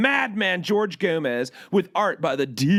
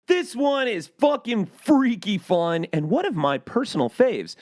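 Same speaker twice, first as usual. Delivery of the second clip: ordinary talk, nothing marked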